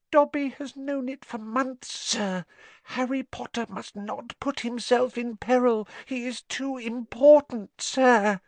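high, squeaky urgent elf; pleading house-elf. Acted. Dobby begging Harry avoid peril, high urgent squeak. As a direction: squeak an anxious plea.

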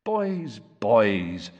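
absent-minded, drawn-out appeal; distracted father's murmured appeal; vague. A narrator's reading. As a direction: say it vaguely, mind elsewhere. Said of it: "said Mister Weasley vaguely".

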